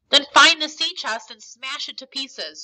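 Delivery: mildly acted screech, starting hard; restrained screech. A narrator's reading. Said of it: "screeched the blind man".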